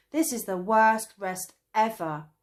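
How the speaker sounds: loud angry complaint; angry cry. A narrator's reading. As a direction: cry out in anger. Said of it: "cried Traveller Fizz angrily".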